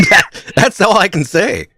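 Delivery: laughing. Real, not acted. Host laughing.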